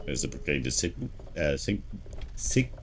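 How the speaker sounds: stumbling over the word, restarting it; tongue-tied stumble. Real, not acted. Player fumbling a word while reading aloud, tongue-tied. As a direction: trip on the first syllable and break off. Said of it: "my God, can't speak".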